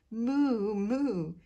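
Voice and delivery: loud, high mooing like a cow. Acted. Cow answering Armando with a loud moo. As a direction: moo back like a friendly cow.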